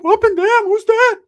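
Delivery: very high, loud comic voice; cousin's put-on voice. Cousin asking about the girl, high loud squawk. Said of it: "Ricky's like".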